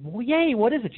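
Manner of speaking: high-pitched mock cheer; excited little bird. Little bird asking about its present, high excited voice. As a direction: play a small bird thrilled by a gift.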